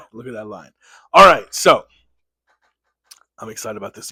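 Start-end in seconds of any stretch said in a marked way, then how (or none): 0.1-0.7 laughing lightly at himself. Real, not acted. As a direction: chuckle through the words. Admiring his fresh beard line.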